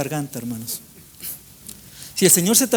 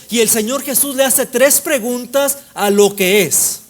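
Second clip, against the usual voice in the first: insistent preacher hammering each repeated phrase; impassioned preaching; 4 semitones higher, 3 dB louder than usual. Real, not acted. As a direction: preach with driving, sustained energy. Preaches at full force.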